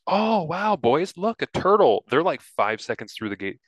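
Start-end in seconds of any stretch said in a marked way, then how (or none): 0.1-2.0 high, eager grandma voice; excited grandma. Retold or imitated. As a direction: point it out like a thrilled grandma. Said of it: "their grandma goes".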